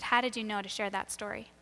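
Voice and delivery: hushed, serious retold question. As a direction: ask softly and in earnest. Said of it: "just gets very serious".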